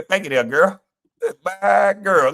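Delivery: high mock-delighted thanks; happy imitation. Streamer mocking how happy TJ was, high mock glee. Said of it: "He was just happy to".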